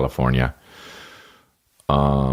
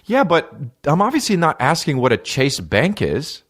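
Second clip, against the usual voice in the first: higher-pitched, mildly acted exasperation; retold exasperation; 8 semitones higher than usual. Passenger fed up with the driver, retold exasperation. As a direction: re-enact an irritated correction, pitch raised. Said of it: "this shit made me fiery red mad".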